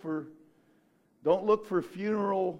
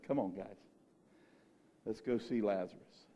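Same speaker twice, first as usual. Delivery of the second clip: low, soft, unbothered; quiet and calm; 10 semitones lower, 10 dB quieter than usual. Retold or imitated. Preacher voicing Jesus calmly leading disciples, quiet. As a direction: shrug it off softly.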